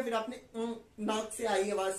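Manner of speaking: ordinary delivery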